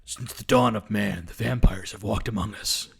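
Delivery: low, slow dramatic voice-over; solemn movie intro voice; movie trailer narration. Pretend. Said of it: "do more of an Anthony Stewart Head accent".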